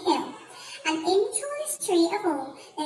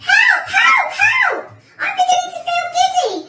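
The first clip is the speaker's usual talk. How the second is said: high, loud panicked shrieks; screamed; 8 dB louder than usual. A narrator's reading. Missus Twit giddy and calling for help, screamed. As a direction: shriek repeatedly for rescue. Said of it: "screamed Missus Twit".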